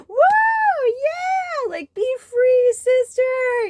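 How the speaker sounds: high, loud whooping cheer; cheering crowd. Retold or imitated. Dance floor cheers her taking off her shirt, whooping.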